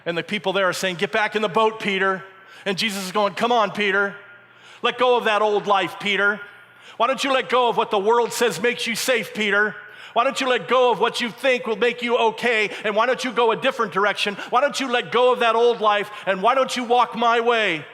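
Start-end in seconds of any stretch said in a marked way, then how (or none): 1.0-2.2 acted calling out. Acts boatmen calling Peter back. 3.3-17.8 loud, higher, insistent pleading; impassioned urging. Acted. Acts Jesus urging Peter on, rising.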